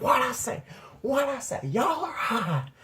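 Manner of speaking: high-pitched imitation of a scolding grandma. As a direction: stretch out the accusation up high. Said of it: "She's like".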